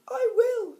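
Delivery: very high, loud little pig; piggy voice. A narrator's reading. Piggy agreeing to sell his ring, high loud squeal. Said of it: "said the piggy".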